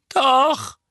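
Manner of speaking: high, loud blurted contradiction; unerwarteter Einwurf. A narrator's reading. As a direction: blurt it out in the boy's voice. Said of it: "murmelte Dudley unerwartet". (hoch und laut dazwischengeworfen)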